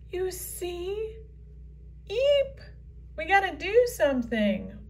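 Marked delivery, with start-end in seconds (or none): 2.1-2.6 sharp high startled eep; startled squeak. Acted. Unicorn startled by the vortex, squeaking high. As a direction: yelp in sudden fright.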